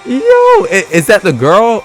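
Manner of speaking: ordinary delivery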